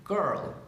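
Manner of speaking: put-on "Girl" with hard G, R running into L; strong G, joined R-L. Shown as a demonstration. Teacher summing up the sound, "Girl" with R-L joined. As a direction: hit the G in "Girl", blend R and L. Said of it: "la R y la L juntas".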